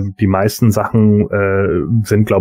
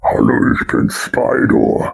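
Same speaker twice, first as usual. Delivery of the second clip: monster voice; 8 semitones lower, slower than usual. Pretend. Mock monster voice intro.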